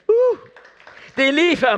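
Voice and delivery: high, loud excited whoop; real excitement. Real, not acted. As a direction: burst out with joy at the story. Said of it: "man kan blive begejstret". (begejstret, højt udbrud)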